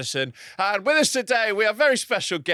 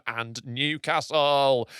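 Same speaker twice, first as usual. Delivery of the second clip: slow drawn-out finale; 4 semitones lower, much slower than usual. Acted. List ends with mock grandeur.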